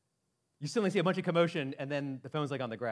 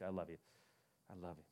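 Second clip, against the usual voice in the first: tender reassurance; 8 semitones lower, 13 dB quieter, faster than usual. Real, not acted. Pastor reassures teased listener.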